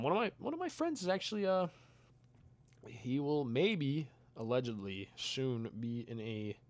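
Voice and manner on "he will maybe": ordinary talk, nothing marked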